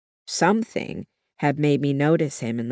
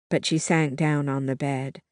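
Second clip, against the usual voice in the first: usual speaking voice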